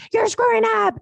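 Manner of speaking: high, pushy complaint on the phone; mock angry buyer. Buyer calling to scold the agent, high mock complaint. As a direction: throw the blame like the irate buyer.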